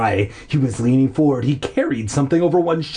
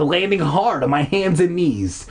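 ordinary delivery

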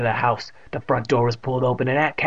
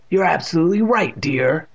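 mildly angry, pitched up; higher, irritated agreement; 5 semitones higher, 4 dB louder than usual. A narrator's reading. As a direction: concede with a touch of anger. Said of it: "in the voice of a angry narrator".

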